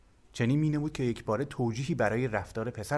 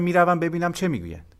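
hurried; 7 semitones higher, 7 dB louder than usual. A narrator's reading. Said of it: "به شتاب گفتم".

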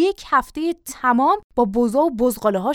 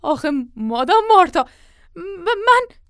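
high, loud faltering plea; cornered, stuttering excuse; stammered protest; 7 semitones higher, 5 dB louder than usual. Acted. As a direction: start to object and stumble on the words. Servant stammers before mistress.

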